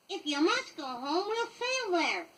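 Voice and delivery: put-on high, slow boyish voice; a lightly voiced boy giving in cheerfully; Pan agreeing. A narrator's reading. Peter Pan agrees to sail Wendy home, high and obliging. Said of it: "said Peter Pan".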